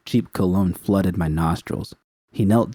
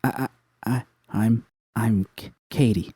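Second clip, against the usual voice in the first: terrified stammer; 3 semitones higher than usual. A narrator's reading. Katie terrified answering the gang boss, stammering. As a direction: stammer out the name, scared. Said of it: "drew a shaky breath".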